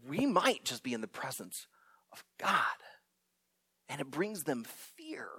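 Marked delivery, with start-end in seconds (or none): 0.1-2.8 awed whisper. Retold or imitated. Disciples realizing they stand before God, awed whisper. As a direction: breathe it out in awe.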